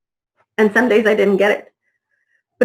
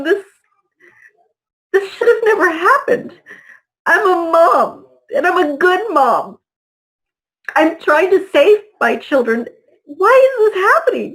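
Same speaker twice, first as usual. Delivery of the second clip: retold terrified plea; 7 semitones higher than usual. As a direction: voice climbing high into a near-cry, pleading. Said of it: "I was just terrified".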